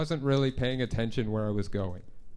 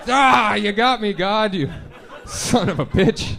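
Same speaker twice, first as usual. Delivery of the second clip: mock defeat; 7 semitones higher, 7 dB louder, slower than usual.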